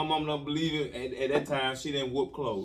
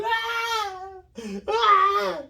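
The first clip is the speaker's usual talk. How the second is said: very high loud cry; hammed-up wail of pain; mock scream; 5 dB louder than usual. Boy screaming through the second whipping, high and loud.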